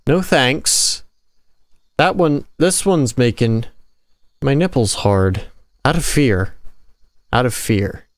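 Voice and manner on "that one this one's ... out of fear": usual speaking voice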